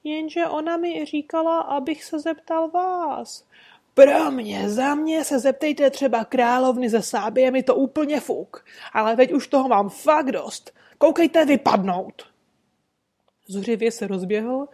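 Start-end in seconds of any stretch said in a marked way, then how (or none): 0.0-3.5 pleading little man. Acted. 3.9-12.2 loud, higher angry dismissal; furious shouting. Acted. Trader throws man out.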